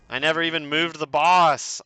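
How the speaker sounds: loud, high cry of disbelief; exasperated outburst. Real, not acted. Player realizing he never moved the boss, exasperated outburst. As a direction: burst out in real frustration at your own slip. Real protest at game mishap.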